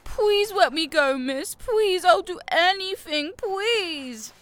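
high, whiny mocking plea; mock pleading. Bully mimicking the captive's pleading in a whiny voice. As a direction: imitate the victim's begging, high and whiny. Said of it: "It's not gonna work".

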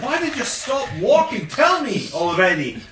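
hammed-up impatience, pressing hard; impatient. Warrior demanding Druella explain why she stopped, loud impatience. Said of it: "I'm getting impatient".

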